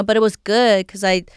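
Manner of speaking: usual speaking voice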